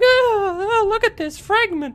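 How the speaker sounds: very high, silly squeal; mocking his oblivious self. Player mocks himself ignoring the warning, silly high voice. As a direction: squeal like a distracted fool. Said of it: "And I was just like".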